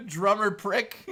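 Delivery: "Drummer prick" said again through real laughter; laughing at the slip. Real, not acted. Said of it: "I just called Drummer Punk a prick".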